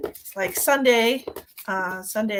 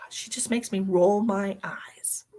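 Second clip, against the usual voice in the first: ordinary delivery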